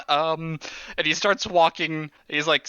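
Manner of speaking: normal talking voice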